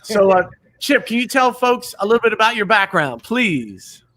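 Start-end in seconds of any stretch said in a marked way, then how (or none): none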